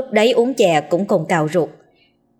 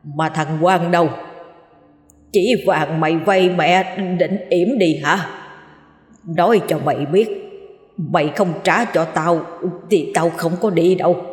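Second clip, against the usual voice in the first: put-on hoarse old woman voice. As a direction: scold like a cranky old ghost grandmother. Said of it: "nói bằng giọng khàn đục đúng của một cụ già".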